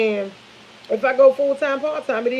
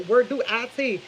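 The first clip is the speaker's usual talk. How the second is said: normal talking voice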